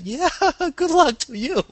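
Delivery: high squeaky voice, laughing and loud; laughing anime girl. Retold or imitated. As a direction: laugh through it like a cartoon girl. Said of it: "And she's like".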